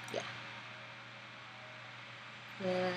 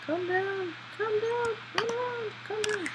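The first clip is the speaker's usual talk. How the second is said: high soothing; 11 semitones higher than usual. Real, not acted. Player cooing at his panicking character, high. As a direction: coo it up high to settle him.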